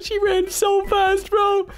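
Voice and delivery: very high, drawn-out laughing words; squealing with laughter. Real, not acted. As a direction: squeak it out through real laughter. Laughing at fleeing player.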